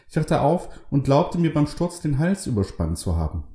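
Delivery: high, lively woman's voice; Carol's voice. A narrator's reading. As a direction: pitch up for the pious wife.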